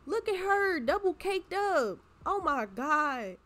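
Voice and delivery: high, loud, slow gushing; teasing delight. Real, not acted. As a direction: hype her up, drawn out. Teasing made-up sim.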